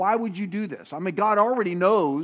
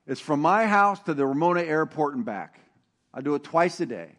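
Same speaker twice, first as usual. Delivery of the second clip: usual speaking voice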